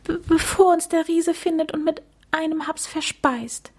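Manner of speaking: high-pitched stammering worry; stuttering. A narrator's reading. Mucks fears being eaten by the giant, stuttering. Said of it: "stotterte er".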